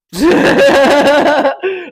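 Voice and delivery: high, loud burst of laughter. Real, not acted. Laughing at his mate's line, a loud high laugh. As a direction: let a big laugh burst out.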